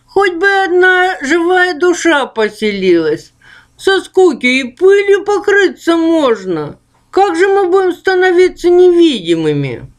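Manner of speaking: high, plaintive ghost voice. Acted. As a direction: whine like a bored little ghost. Ghost Okh keeps voice.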